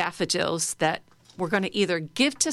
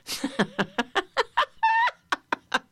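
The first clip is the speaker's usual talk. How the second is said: a free laugh rising much higher; louder laugh. Shown as a demonstration. Teacher leading laughter yoga, laughing louder. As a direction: let the laugh out a bit more. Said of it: "And a little louder".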